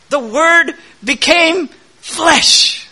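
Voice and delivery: The Word became flesh proclaimed high, loud and slow; slow ringing pulpit proclamation of "The Word became flesh"; preaching climax. Acted. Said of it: "climax of the sermon's build".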